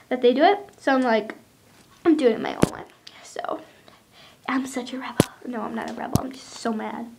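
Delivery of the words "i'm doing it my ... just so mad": soft whispered defiance; quiet whispered huff; whispered grumble. Real, not acted. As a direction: whisper it to yourself, fed up. Whispered rebel aside to camera.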